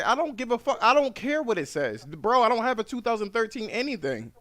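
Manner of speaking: loud, high furious yell. Retold or imitated. Driver yelling at the phone operator, furious. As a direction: shout him down, done with it. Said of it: "I said".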